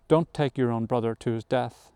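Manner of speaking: usual speaking voice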